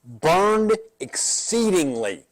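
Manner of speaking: burned exceedingly drawn out slowly, every syllable weighed; slow emphasis. Shown as a demonstration. Pastor stressing a Hebrew phrase to the class, slow and weighty. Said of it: "the word is, in Hebrew".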